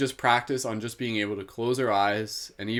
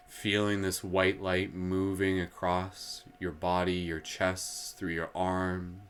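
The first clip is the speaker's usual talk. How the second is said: guided meditation. Acted. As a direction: draw the listeners gently inward.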